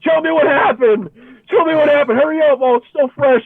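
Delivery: high, pushy and excited urging; eager brother. Retold or imitated. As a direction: hurry him along eagerly.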